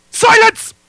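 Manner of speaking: very loud high bellow; booming command. Acted. Actor bellowing a command, far too loud. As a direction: roar it to shut the room. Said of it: "maybe not that loud".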